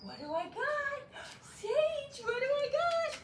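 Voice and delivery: high playful tease with a cookie; high teasing voice to amp up the puppy; teasing excitement. Shown as a demonstration. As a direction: tempt the puppy, high and singsong. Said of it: "gently kind of holding the puppy back".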